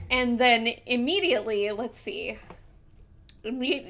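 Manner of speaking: usual speaking voice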